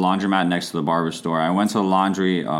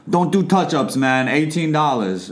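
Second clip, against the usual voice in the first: put-on a higher-pitched Yiddish-tinged New York accent, slower on the price; 7 semitones higher, slower than usual. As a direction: imitate the barber's New York accent, slightly slowed on the fee. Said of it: "Good New York accent".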